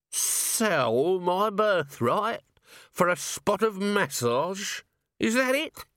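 tipsy little man getting offended; drunk Nobby. A narrator's reading. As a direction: drawl it like a swaying drunk. Drunk Nobby, swaying in the pub.

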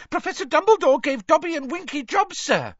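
very high, excited elf squeal; Dobby, excited squeal. A narrator's reading. House-elf announcing new jobs to Harry, squealing excitedly. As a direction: burst out with good news in a squeaky voice. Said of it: "Dobby squealed excitedly".

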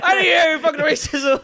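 loud, high mock denial; mock indignant shout. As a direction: shout the denial in fake outrage. Mock denial, repeating louder.

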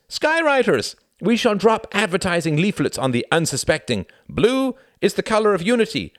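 high, loud, boastful club member; proud young pilot. A narrator's reading. Pilot explaining the flying club to Tom, proudly declaiming. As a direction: proclaim it grandly to the visitor.

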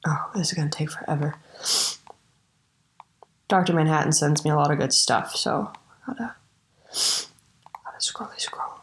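whispered grumbling to herself; whispering. Real, not acted. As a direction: mutter it under your breath. Muttering while scrolling.

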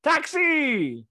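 high, yelled New York caricature; badly faked, loud New York accent. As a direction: holler for a cab like a cartoon New Yorker. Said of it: "and New York, it, it is very".

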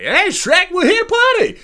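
very high, loud silly Donkey squeal; squeaky party-crasher; goofy Donkey voice. Retold or imitated. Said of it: "They're just like".

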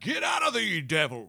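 slow, high, booming preacher yell; shouting preacher. Retold or imitated. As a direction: cast out the devil at full volume. Mocking a loud preacher.

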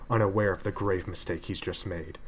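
plain reading voice

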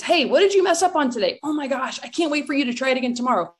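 a parent's bright, high, fast praise; praising parent. Pretend. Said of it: "give them praise".

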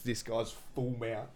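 ordinary talk, nothing marked

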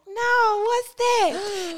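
put-on high, airy clueless girl voice; clueless girlfriend. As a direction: chirp it out like she's never heard of it. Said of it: "She was like".

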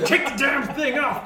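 high, loud demand; angry shouting. Acted. Server yelling at Todd in the kitchen, angry shout. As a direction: yell it as a furious order. Acted kitchen quarrel heard through door.

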